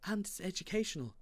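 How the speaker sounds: high, quick motherly addition; mum's voice. A narrator's reading. Mum backing Dad's library idea, high and quick. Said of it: "added Mum".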